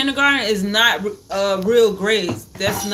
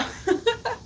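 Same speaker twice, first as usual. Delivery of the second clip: cutesy giggle; 13 semitones higher, 6 dB quieter than usual. Real, not acted. Giggle after baby voice.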